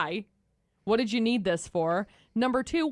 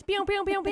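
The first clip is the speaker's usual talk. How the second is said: laser gun sound; 11 semitones higher than usual. Pretend. Laser noises as show intro.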